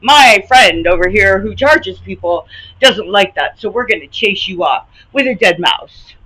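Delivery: sarcastic imitation of the gym owner, loud and scornful; mocking sarcasm. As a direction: sneer it out like you're quoting his excuse. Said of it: "To be like".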